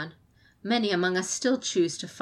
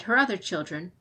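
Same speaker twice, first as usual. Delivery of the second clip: ordinary delivery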